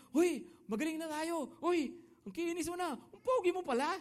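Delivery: high, loud, excited chatter; healed men marveling at each other, bright and high; excited lepers. Retold or imitated. Preacher voicing the healed lepers, excited and high-pitched.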